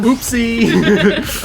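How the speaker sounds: high, loud, laughing "Oopsie!"; cheerful catchphrase. Acted. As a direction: sing out the catchphrase. Segment catchphrase.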